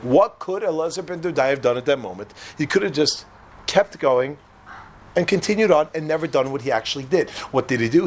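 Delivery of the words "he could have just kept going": ordinary talk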